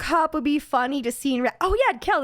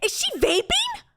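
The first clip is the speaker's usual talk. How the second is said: higher, slower incredulous repeat; incredulous disbelief. Real, not acted. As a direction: stretch out the disbelief.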